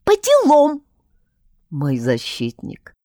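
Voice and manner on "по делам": high, loud little voice; little creature voice. A narrator's reading. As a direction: squeak it out proudly. Bon's retort. (писклявый голосок малыша)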